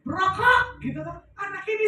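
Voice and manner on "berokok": high, loud one-word call; retold yell. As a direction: yell it out to get the child jumping. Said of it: "kalau saya sudah teriak". (teriakan lantang bernada tinggi)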